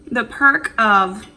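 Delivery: talking while chewing; words round a bite of food; mouth full. Real, not acted. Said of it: "I'm eating with my mouth open".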